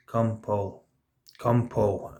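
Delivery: lightly imitated voice, low and soft; quietly beckon, pitched a little down; mild, hushed retelling of a summons; hallucinated voice calling. Imagined voice leaning over him.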